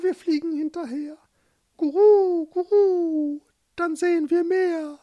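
high, cooing bird voice; dove voice. Acted. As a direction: speak like a fretful little dove. Doves voiced in story.

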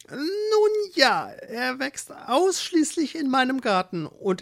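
hohe, lauernd freundliche Hexenstimme; gönnerhaft wie eine listige alte Hexe; Kräuterhexe. Acted. Hexe ziert sich. (Hexe empfängt Eduard, hoch und gönnerhaft)

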